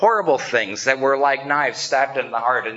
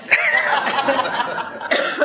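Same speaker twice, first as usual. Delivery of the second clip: laugh; 11 semitones higher than usual. Real, not acted. Laughs at own joke.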